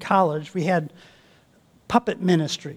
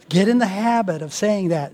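ordinary talk

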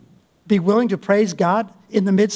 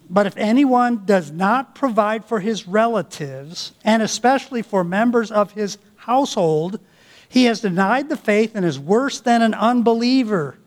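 raised, emphatic Bible reading; scripture reading; 3 semitones higher than usual. Acted. Preacher reads verse to congregation, emphatic. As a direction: proclaim the verse to the church.

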